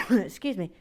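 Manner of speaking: normal talking voice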